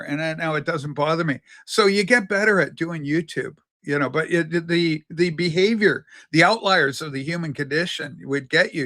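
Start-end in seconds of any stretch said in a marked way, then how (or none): none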